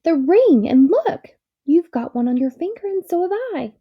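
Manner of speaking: thrilled discovery, voice up high; excited. A narrator's reading. Dickory to the girl about the rings, excited. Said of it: "cried Dickory".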